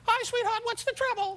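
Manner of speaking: very high, light bird-like voice. Retold or imitated. Rickles imitates his old manager, very high bird voice. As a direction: squeak it up high like a tiny bird. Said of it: "he had a voice like a bird".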